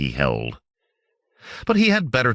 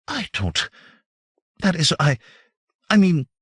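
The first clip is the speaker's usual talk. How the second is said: stammering; 7 semitones higher than usual. A narrator's reading. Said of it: "even stammering".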